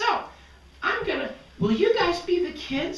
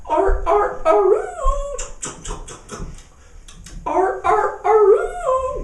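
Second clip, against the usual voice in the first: high loud wolf-like aroo; howling ringtone; 7 semitones higher, 6 dB louder, slower than usual. Acted. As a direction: howl it like a ringing phone. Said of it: "What is that noise".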